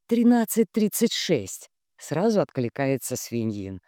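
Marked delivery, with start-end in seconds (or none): 0.1-1.7 high, even reply of the number; supply the number promptly; raised, matter-of-fact prompt; prompting helper. A narrator's reading. Svinin answers at once.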